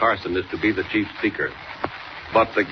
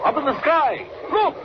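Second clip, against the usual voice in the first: high, ringing announcer cry; excited call-out. Acted. Announcer hails the hero to listeners, ringing call. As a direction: call it out to the whole sky. Repeated serial opening call-out.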